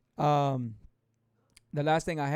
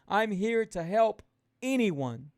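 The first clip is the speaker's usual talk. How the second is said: ordinary talk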